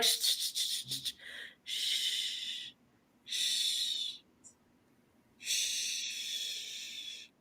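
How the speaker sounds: soft, repeated shushes; soothing mock "shh", stretched longer each time; drawn-out shushing. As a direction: hush someone slowly, over and over. Acts out followers shushing Doe.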